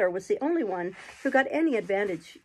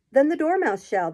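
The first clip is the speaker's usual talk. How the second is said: crying out; 6 semitones higher, 6 dB louder than usual. A narrator's reading. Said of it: "they both cried".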